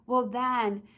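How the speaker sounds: normal talking voice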